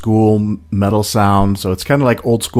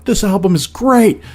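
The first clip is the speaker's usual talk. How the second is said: excited; 12 semitones higher than usual. Retold or imitated. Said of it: "I'm like".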